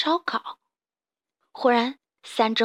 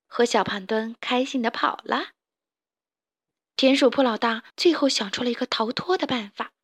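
usual speaking voice

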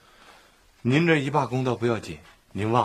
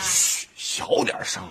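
shush, then slow hushed words; 6 semitones higher, 3 dB quieter, slower than usual. Acted. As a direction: tell the child to keep it down. (嘘声提醒小声点)

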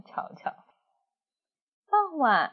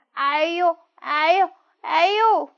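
loud, higher cries of pain; wolf howling in pain; pained yelps; 9 semitones higher, 6 dB louder, slower than usual. Acted. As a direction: yelp as the tail gets yanked. Wolf's tail caught in waterwheel.

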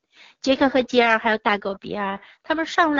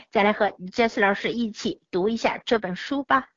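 ordinary delivery

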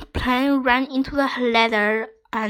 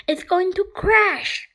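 higher-pitched alarm; mildly panicked, raised voice; alarmed gasp; 7 semitones higher than usual. A narrator's reading. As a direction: exclaim as the crash looms. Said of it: "gasped Nadim".

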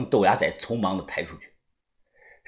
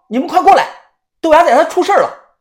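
high, loud cry for help; desperate loud shout; panicked shout; 13 semitones higher, 7 dB louder, faster than usual. A narrator's reading. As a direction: yell for the others to come now. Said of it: "我立即大喊".

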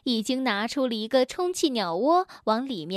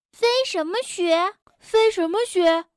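baffled echo; 6 semitones higher, 3 dB louder than usual. A narrator's reading. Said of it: "异口同声地问道".

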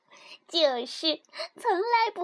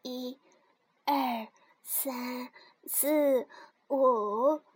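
soft counting; 7 semitones lower, 5 dB quieter, much slower than usual. A narrator's reading. Counting with eyes covered.

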